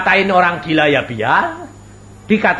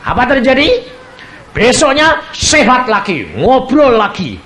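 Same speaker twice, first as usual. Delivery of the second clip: louder, higher, slow marvelling; excited; 9 semitones higher, 6 dB louder than usual. Real, not acted. Preacher marvelling to the congregation, louder and slow. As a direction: build the wonder.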